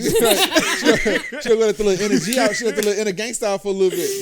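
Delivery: laughing tease. Real, not acted. Laughing excitedly teasing.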